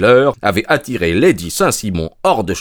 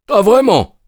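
bitter exclamation; 12 semitones higher, 6 dB louder than usual. A narrator's reading. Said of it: "S'exclama Lestrade avec amertume".

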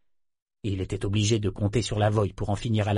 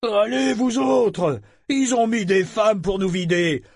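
high, loud pirate yell, slowing at the end; rally the men below with a bellow; shouting to the boat; 8 semitones higher, 3 dB louder, slower than usual. Acted. Pirate shouting down to the men in the canoe, loud rallying yell. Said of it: "il cria aux hommes dans le canot".

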